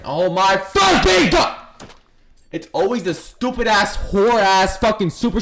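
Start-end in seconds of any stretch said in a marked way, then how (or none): 0.0-1.6 slow, loud exasperated yell; drawn-out angry shout; frustrated shout. Real, not acted. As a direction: blow up at the game. Rant outburst, shouted.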